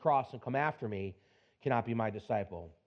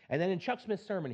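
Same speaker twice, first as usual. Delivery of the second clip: ordinary talk, nothing marked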